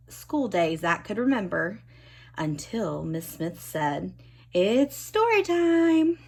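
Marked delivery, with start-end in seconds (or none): 4.5-6.2 high, slow, louder sing-song; story time announcement. A narrator's reading. Teacher announcing story time to class, high and slow. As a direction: announce it like a treat.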